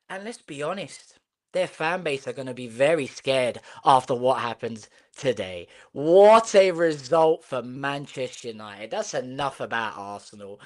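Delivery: ordinary talk, nothing marked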